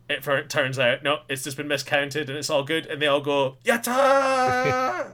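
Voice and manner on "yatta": high, loud cartoony victory cheer; mock cheer. Staff celebrating the till coming out right, high loud cheer. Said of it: "cheer and scream".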